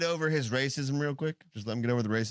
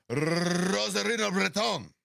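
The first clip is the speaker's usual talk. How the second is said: slow, higher Spanish name with huge trill; exaggerated Spanish accent; 5 semitones higher, 3 dB louder, slower than usual. Host contrasts it with the other names, exaggerated Spanish accent. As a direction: roll the Spanish R as long as possible. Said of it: "You don't like that?".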